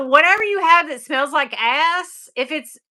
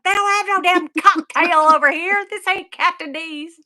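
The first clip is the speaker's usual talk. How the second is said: grumpy Bill retort; 6 semitones higher, 3 dB louder than usual. Retold or imitated. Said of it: "So he's like".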